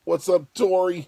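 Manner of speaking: high, loud shout-out; loud greeting. Real, not acted. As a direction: call out to her, high and loud. Shouting hello to viewer.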